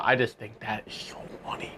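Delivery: soft, whispery aside; amused hushed aside. Real, not acted. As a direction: drop to a near-whisper, amused. Amused aside after own impression.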